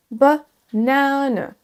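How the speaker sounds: BANANE broken into weak buh, long NA-nuh; schwa peu accentué. Shown as a demonstration. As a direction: reduce the first vowel, stretch NA in BANANE. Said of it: "un E très peu accentué". (BANANE en syllabes, accent sur NA)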